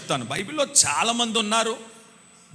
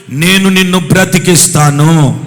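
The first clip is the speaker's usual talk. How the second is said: much louder, lower proclamation; loud, lower divine declaration; booming voice of God; 4 semitones lower, 12 dB louder than usual. Acted. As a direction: thunder the promise as the Lord. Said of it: "ప్రభు చెప్తున్నాడు".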